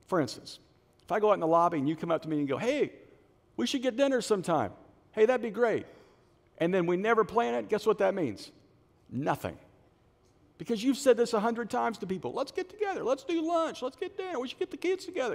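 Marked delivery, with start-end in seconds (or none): 2.6-5.9 high, breezy social chit-chat; mock friendly invitation. Churchgoer greeting pastor in the lobby, mock friendly. 12.3-15.3 very high-pitched chirpy list of invitations; mock social enthusiasm. People making plans they won't keep, mock enthusiasm.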